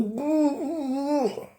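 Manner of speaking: high nasal mm of a kiss; kissing hum. Pretend. Mimicking a couple kissing, high kissing hum. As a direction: hum like lips locked in a kiss. Mimed kissing sound again.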